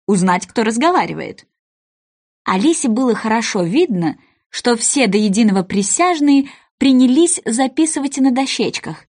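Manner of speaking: ordinary delivery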